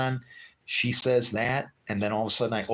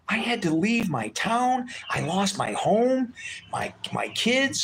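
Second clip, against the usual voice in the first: put-on slow, high, fading to a whisper on kids; telling a stranger what he lost, slow and sad; heartfelt lament; 12 semitones higher, slower than usual. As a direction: let it break as you name the losses. Retelling losing home and kids.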